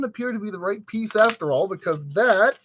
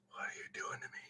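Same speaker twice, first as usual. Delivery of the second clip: whisper; 24 dB quieter, much faster than usual. Real, not acted. Pleading with the furniture piece.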